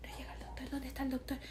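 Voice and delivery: hushed, whispered worried phone talk; imitating a furtive whispered call; midwives on the phone. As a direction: whisper it urgently, as if not to be overheard. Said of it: "por teléfono enfrente mío diciendo".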